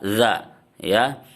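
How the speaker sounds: ordinary talk, nothing marked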